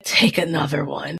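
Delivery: low, hushed whisper as the half-asleep partner; voiceless, understated whisper; whispered reply. Retold or imitated. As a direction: whisper it as a retold instruction.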